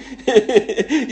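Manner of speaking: loud high-pitched laugh; cheeky loud laugh. Real, not acted. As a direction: burst out laughing. Flirting with blonde trainer.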